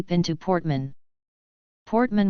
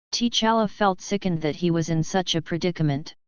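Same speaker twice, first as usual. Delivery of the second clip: ordinary delivery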